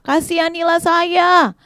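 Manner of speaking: high, drawn-out plea; crying out for mercy. Acted. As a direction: beg aloud, stretching the words. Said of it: "Bartimeus tetap teriak adik-adik". (seruan panjang memohon belas kasihan)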